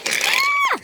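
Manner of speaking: very high thin squeal; scream. Acted. Rosie as the coach flies forward, high squeal. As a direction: squeal at the very top of the voice. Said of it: "she screamed".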